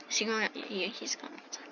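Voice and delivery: whispered aside under the breath. Real, not acted. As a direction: drop to a hushed whisper. Whispered aside during play.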